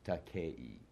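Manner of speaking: Takei sounded out as ta-KAY-ee; Mediterranean vowel sounds. Shown as a demonstration. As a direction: let Takei glide from "eh" into "ee". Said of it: "E is given the S sound".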